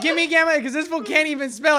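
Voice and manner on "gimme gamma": "Gimme gamma" with both g's hard; misread with G. Retold or imitated. Explaining why dad said it, "Gimme gamma" with hard g's. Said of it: "He probably spelt it with a G".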